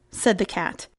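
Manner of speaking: ordinary delivery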